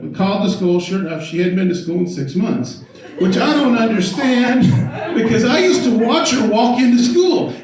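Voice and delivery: ordinary delivery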